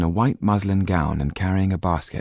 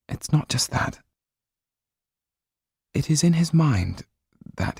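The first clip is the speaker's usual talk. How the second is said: higher voice, heavy with worry; troubled young woman; 7 semitones higher than usual. A narrator's reading. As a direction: confide her father's madness. Sido's dialogue.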